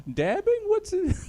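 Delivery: put-on high, puzzled old-man voice; grandpa voice. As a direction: play a confused grandpa hearing slang. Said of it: "I'm gonna put on my grandpa voice".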